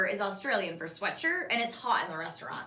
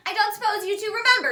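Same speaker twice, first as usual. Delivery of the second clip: a higher, louder, different voice for Gran; lightly voiced gran; 11 semitones higher, 9 dB louder than usual. A narrator's reading. Gran asks the girls, lightly voiced and amused. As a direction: grandmother teasing the two girls. Gran's voiced line in story.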